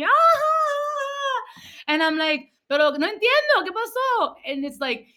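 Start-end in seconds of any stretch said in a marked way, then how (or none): 0.0-1.9 very high mock cackle; imitated grandma's laugh. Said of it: "my grandma starts laughing". 2.7-4.3 high-pitched acted confusion; baffled. Acts out own confusion to mom.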